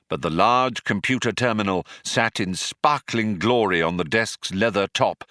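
ordinary delivery

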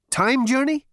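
high, loud, startled question; Zaphod. Acted. Zaphod repeats it in surprise.

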